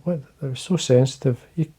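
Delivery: usual speaking voice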